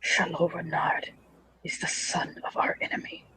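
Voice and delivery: very soft, near-whispered reproach; hushed warning. Acted. He warns her about the enemy's son, hushed. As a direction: keep it right down, almost all breath.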